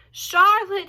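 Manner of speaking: high, loud, drawn-out moan. A narrator's reading. Wilbur moaning to his dying friend, high and loud. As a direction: moan her name in grief. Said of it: "he moaned".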